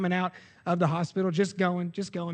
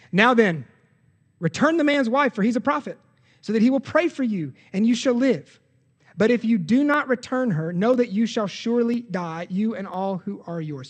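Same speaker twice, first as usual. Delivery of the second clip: loud, higher stern proclamation; preaching God's warning; 4 semitones higher, 7 dB louder than usual. Acted. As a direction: thunder God's ultimatum to the king. Reads God's warning with force.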